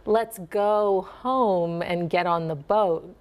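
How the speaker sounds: slow, with the dip in home; softened Minnesota accent, slow dip. Shown as a demonstration. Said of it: "if you wanted to soften your Minnesota accent".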